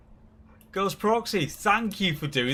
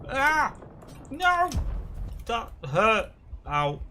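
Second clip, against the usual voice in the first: pained yelp; 9 semitones higher, 5 dB louder, slower than usual. Real, not acted. In-game character gets hurt.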